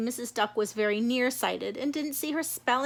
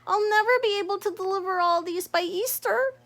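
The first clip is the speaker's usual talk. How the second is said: high, worried frog voice; overwhelmed little frog; dismayed Froggy; 7 semitones higher than usual. Acted. Froggy facing the baskets, high dismayed voice.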